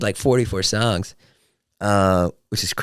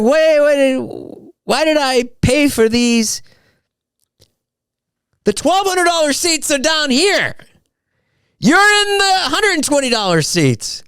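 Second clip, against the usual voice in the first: indignant fan; 4 dB louder, slower than usual. Retold or imitated. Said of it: "I was like".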